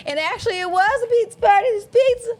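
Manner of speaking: high, loud and fast with delight; excited. Real, not acted. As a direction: squeal about the pizza. Excited about the pizza.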